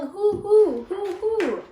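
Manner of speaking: high hooting owl call; imitating an owl. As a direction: copy the owl's hoot. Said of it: "It keeps calling".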